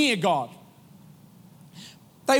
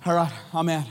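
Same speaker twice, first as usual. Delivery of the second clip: offhand sign-off; 8 semitones lower, faster than usual. Retold or imitated. Churchgoer casually leaves worship, low and offhand. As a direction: brush it off and head out.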